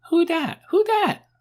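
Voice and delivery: put-on high, loud little-child squeal; small piercing child voice. A narrator's reading. Toddler asking about the shouting man, small piercing voice. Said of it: "said Poppy in her small, piercing voice".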